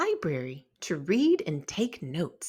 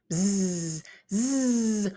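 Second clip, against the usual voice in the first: bug buzz. A narrator's reading. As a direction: buzz like a bug flying about. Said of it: "Wentz heard as the bug flew about".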